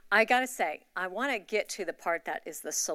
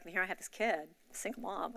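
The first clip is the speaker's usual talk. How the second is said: normal talking voice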